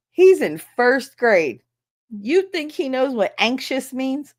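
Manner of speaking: slow, loud, pointed disbelief; incredulous retort. Retold or imitated. Retorting to the administrator, slow and loud. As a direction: spell out the absurdity slowly.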